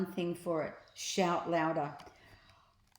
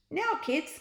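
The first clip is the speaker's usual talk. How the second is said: much higher calling voice; higher cheerful call; 10 semitones higher than usual. A narrator's reading. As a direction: call across the shop. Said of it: "called out Ned".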